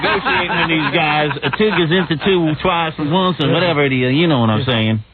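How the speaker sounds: hammed-up hillbilly speechmaker; Jethro. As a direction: play a country bumpkin giving a talk.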